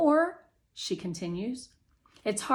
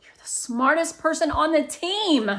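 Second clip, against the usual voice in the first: exclaiming; 8 semitones higher, 7 dB louder than usual. A narrator's reading. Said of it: "Catherine exclaims".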